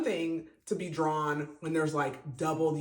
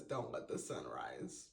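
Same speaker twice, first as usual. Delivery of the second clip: low, much softer grave line; hushed ominous quote; 9 semitones lower, 12 dB quieter than usual. Retold or imitated. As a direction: read it like a dark prophecy. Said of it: "and she says".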